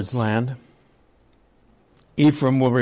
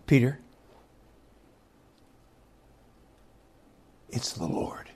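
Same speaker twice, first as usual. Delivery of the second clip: hushed, lower, breathy realization; awed whisper; 5 semitones lower, 9 dB quieter than usual. Retold or imitated. John telling Peter who stands on the shore, awed whisper. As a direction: whisper it in awe. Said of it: "And John says".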